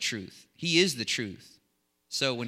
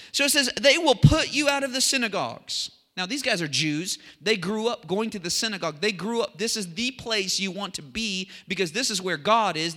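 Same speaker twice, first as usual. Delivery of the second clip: raised, higher preaching voice; fervent preaching; 6 semitones higher, 5 dB louder than usual. Real, not acted. Preacher explains what Jesus said to his followers, fervent preaching. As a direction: drive the teaching home like a preacher.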